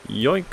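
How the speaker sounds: high quick yoink; snatch it with a yoink; playful yoink. Acted. Grabbing sound effect.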